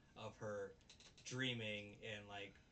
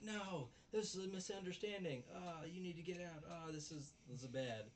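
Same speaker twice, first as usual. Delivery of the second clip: much higher, frantic denial; flustered high protest; 7 semitones higher than usual. Retold or imitated. Girl panicking in front of her roommates, high and frantic. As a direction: plead it's all a misunderstanding. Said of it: "She's like".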